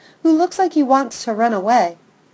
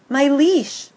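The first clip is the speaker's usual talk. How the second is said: ordinary delivery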